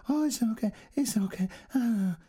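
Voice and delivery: high cooing to a little dog. A narrator's reading. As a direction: comfort the pet like a baby. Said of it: "she cooed to it".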